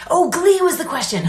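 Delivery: high excited oh; realizing with a jolt; sudden realization. Real, not acted. Suddenly remembers the question.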